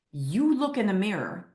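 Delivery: ordinary delivery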